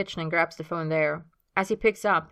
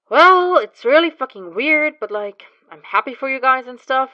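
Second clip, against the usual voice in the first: grudging acceptance; 8 semitones higher, 12 dB louder than usual. Acted.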